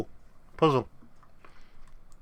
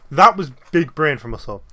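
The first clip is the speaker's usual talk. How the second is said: smug loud gloat; 3 semitones higher, 11 dB louder than usual. Real, not acted. Gloating after solving puzzle.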